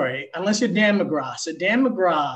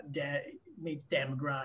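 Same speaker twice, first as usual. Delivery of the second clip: normal talking voice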